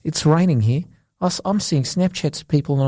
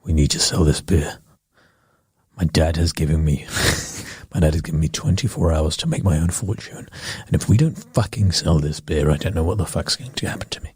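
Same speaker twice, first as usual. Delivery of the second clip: desperate boss voice; 9 semitones lower than usual. Pretend. Boardroom boss skit.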